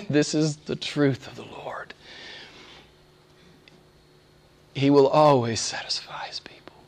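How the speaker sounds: soft, awed whisper; whispered wonder; hushed whisper. Real, not acted. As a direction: breathe it like a secret. Hushed reflection on the truth.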